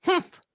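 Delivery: high, loud huff; sulky humph. Acted. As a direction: huff like a pouting little creature. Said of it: "sits down on the ground and goes".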